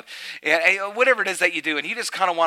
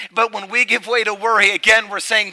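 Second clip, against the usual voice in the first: much louder and higher fervent sermon voice; full-force preaching; 6 semitones higher, 8 dB louder than usual. Acted. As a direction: preach it out to the whole room.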